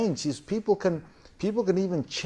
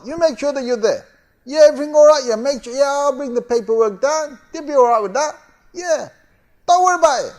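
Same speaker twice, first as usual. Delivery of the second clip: bright, much higher and louder friendly work call; cheery phone voice; 10 semitones higher, 7 dB louder than usual. Acted. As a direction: switch to an upbeat chummy boss on the phone. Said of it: "emotional queen ... takes the phone".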